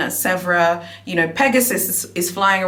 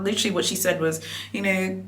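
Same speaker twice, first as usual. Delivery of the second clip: normal talking voice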